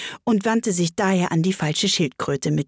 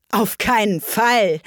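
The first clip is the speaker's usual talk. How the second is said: higher, slow emphatic refusal; affronted and emphatic; indignant; 4 semitones higher, 4 dB louder, slower than usual. Acted. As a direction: refuse it indignantly, each word stressed. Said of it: "sagte Alice entrüstet".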